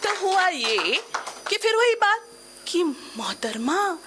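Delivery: theatrical middle-aged woman, lively and rueful; middle-aged woman monologue. Acted. Woman confides her midlife confusion to the audience, theatrical storytelling. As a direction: tell it as a stage monologue, with dramatic pauses.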